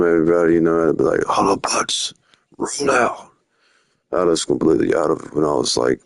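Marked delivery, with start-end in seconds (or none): 1.2-3.3 higher, hammed-up robot-hero rally call; Optimus Prime impression. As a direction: do a mock Optimus Prime command. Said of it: "I had to, Optimus Prime".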